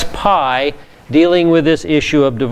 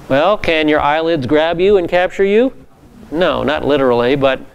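ordinary talk, nothing marked

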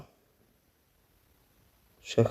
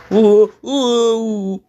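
high whimpering fake sobs, much higher; hammed-up fake crying over a lost round; mock crying; 13 semitones higher, 3 dB louder than usual. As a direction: whine like you're about to cry over the game. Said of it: "Arkadaşlar ağlayacağım".

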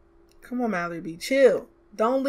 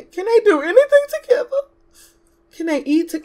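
higher, louder exasperated plea; higher, louder plea in exasperation; 8 semitones higher, 6 dB louder than usual. Real, not acted. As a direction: throw up your hands in disbelief. Exasperated with the game.

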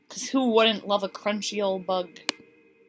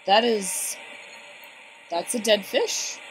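ordinary talk

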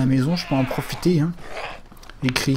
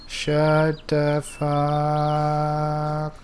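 slow menacing growl; 3 semitones lower, 4 dB quieter, much slower than usual. Acted.

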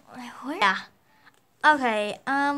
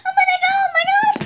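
squealing; 11 semitones higher, 6 dB louder, much faster than usual. Pretend. Hyper pet squealing.

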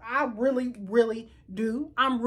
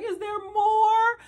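imitating crying; 9 semitones higher, 4 dB louder, slower than usual. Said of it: "She even cried".

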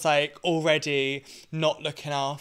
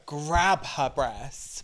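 usual speaking voice

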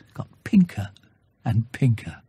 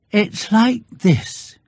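very slow, higher bear voice; Pooh musing; 7 semitones higher, much slower than usual. Acted. Bear begins explaining his honey plan, slow and thoughtful. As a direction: draw it out thoughtfully.

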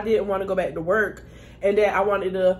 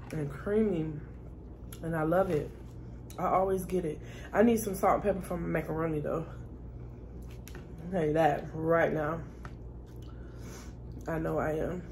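talking through a mouthful of food; words round a bite of food; mouth full; 4 semitones lower, 8 dB quieter than usual. Real, not acted. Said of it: "I didn't wanna talk with my mouth full".

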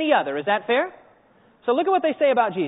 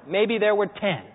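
usual speaking voice

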